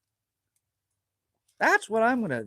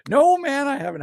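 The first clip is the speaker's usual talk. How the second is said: loud; 9 semitones higher, 11 dB louder than usual. Real, not acted. Loud reply to chat.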